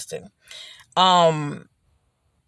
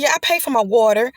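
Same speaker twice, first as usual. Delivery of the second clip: irritated retort; 6 semitones higher than usual. Retold or imitated. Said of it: "I was just so ticked off".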